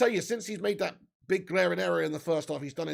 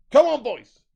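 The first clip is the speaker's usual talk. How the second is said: loud, higher rallying yell; shouted urging; 6 semitones higher, 15 dB louder than usual. Real, not acted. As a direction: urge the team on from the sofa. Calling out to the team.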